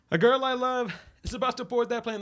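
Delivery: high, loud melodramatic plea; dramatic pleading. Retold or imitated. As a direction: overact a desperate hero. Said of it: "the guy is like".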